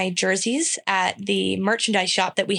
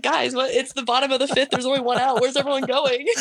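confused; 4 semitones higher than usual. Retold or imitated. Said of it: "I was like".

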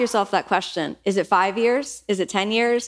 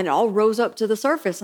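ordinary delivery

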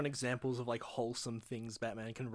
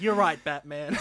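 Robin's line through a laugh; laughing mock Robin; 7 dB louder, slower than usual. Robin agreeing with Batman, laughing. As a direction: giggle it out as Robin. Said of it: "it's like".

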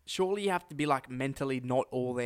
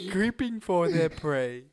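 documentary narrator; 4 semitones higher, 4 dB louder, slower than usual. Acted. Continues the documentary bit.

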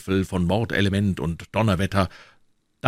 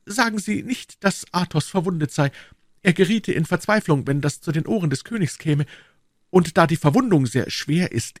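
much higher, polished courtier's voice; higher, gentle, dandyish soldier; refined musketeer; 10 semitones higher than usual. Acted. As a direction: Aramis boasting elegantly. Aramis asks a favour.

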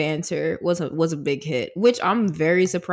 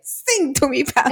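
higher mock Italian accent; 7 semitones higher than usual. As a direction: repeat the film quote in a playful Italian accent. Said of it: "I can do an Italian accent".

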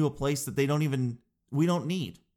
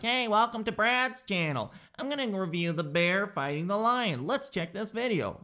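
YouTuber voice; 7 semitones higher than usual. Retold or imitated. Mocking YouTuber intro voice.

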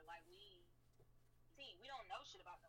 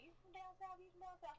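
mimicking people asking, higher; 10 semitones higher than usual. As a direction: pitch it up like curious strangers. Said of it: "Everybody be asking me".

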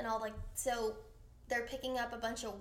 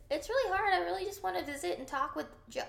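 higher-pitched, put-on girlish impression; mock high voice, quickening; Kendra voice; 7 semitones higher, 5 dB louder, faster than usual. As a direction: imitate her in a high, hurried little voice. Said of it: "that's how Kendra talks".

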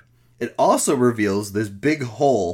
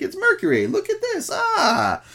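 much higher, hammed-up thrilled squeal; mock excitement. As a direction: squeal like a kid who found treasure. Mocking soldiers playing with mercury.

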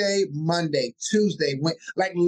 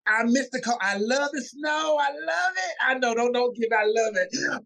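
higher-pitched, gushing fondness; real enthusiasm; 5 semitones higher than usual. Real, not acted. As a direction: gush about loving it, pitch climbing. Enthusing about snow and cold.

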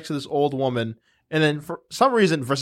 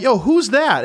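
high, raised-voice retold surprise; startled question; 9 semitones higher, 4 dB louder, slower than usual. Dreamer spotting the crying girl with his friend, startled retold question. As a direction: blurt it high, acting taken aback.

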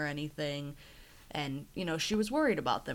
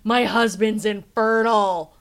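louder, slow dramatic reveal; deadpan reveal; 4 semitones higher, 13 dB louder than usual. Retold or imitated. Pregnant patient telling Mark her husband's infertile, slow and loud. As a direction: land the line slowly and heavily. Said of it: "And she's like".

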